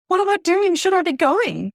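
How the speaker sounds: high, loud voice of fretful doubt; anxious self-questioning. Retold or imitated. Woman recalling her uncertainty about going, anxious self-questioning. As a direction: imitate your own worried, panicky questions.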